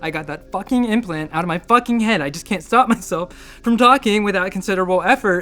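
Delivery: agitated rant. Acted. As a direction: rant on with rising exasperation. Agitated, unstoppable rant about past.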